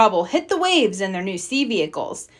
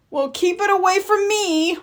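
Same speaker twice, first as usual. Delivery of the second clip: usual speaking voice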